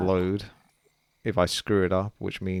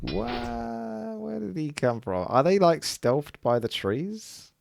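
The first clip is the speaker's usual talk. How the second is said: baffled; 10 semitones higher, slower than usual. Real, not acted. Gamer ambushed by enemy unit.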